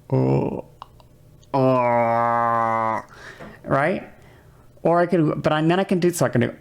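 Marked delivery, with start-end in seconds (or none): 0.1-3.0 low, hollow held vowels; lowered resonance. Shown as a demonstration. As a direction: sustain a low ooh sliding into oh. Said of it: "I could lower the resonance".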